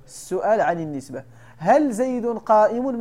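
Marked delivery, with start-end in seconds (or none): 1.6-3.0 هل زيد قائم said slowly and a bit louder, هل stressed; slow emphatic example. Shown as a demonstration. Teacher demonstrating the question to students, slow and emphatic. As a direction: lay out each word deliberately. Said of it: "ملي كتقول".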